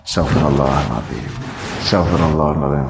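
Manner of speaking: low, soft prayer murmur; murmured istighfar. Shown as a demonstration. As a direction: mutter the istighfar to calm down. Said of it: "suruh istighfar". (istighfar lirih dan rendah)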